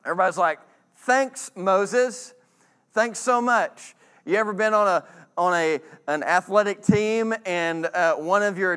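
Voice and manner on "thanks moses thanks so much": higher, slow, dripping sarcasm; drawn-out mock gratitude; sarcastic thanks.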